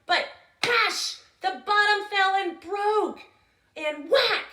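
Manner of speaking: booming high sound-effect words; loud crash. A narrator's reading. Storyteller booming the crashes to listeners. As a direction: bang out the crash like a drum.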